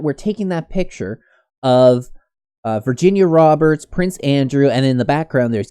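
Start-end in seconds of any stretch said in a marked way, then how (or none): none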